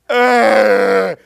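big, loud, drawn-out groaning breath; loud mock exhale. Mocking a colleague's Hebrew word, loud hammed-up groaning exhale. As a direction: heave out an exaggerated groan. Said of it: "exhale deeply".

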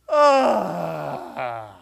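long falling exaggerated sigh; mock groaning exhale. Mocking a colleague's second definition, long exaggerated groaning sigh. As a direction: heave out a hammed-up groan. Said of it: "here's definition number two".

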